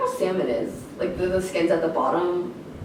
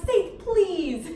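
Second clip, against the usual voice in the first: parents correcting; 12 semitones higher, 4 dB louder than usual. Retold or imitated.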